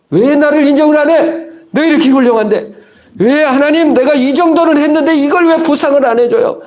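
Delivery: high, loud aggrieved complaint, nearly shouting; resentful outcry. Acted. Gideon protesting to God, high and loud resentful outcry. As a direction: pour out grievance at not being rewarded.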